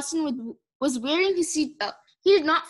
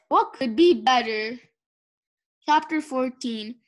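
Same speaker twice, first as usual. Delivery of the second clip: ordinary delivery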